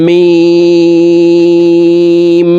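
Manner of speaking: "মিম" with a very long ee on a high level tone; four-alif stretch. Shown as a demonstration. Teacher demonstrating meem, long drawn-out hold. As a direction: draw out "মিম" as one steady chanted note. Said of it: "মিম হরফকে চার আলিফ টেনে পড়ব".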